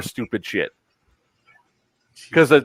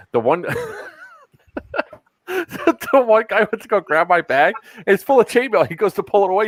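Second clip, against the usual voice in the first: words squeezed out through laughter, pitched up; laughing; 10 semitones higher than usual. Real, not acted. Laughing while telling friends about the airport guards.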